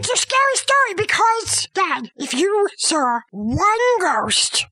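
high, insistent explaining; real indignant protest. Real, not acted. Child defending the scary story to Dad, indignant.

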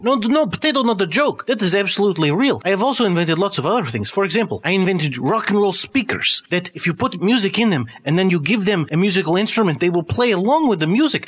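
put-on high, insistent accented scientist; eccentric doctor. Doctor defends his inventions to the host, in a high insistent accented voice. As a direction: boast in an excitable foreign doctor's voice.

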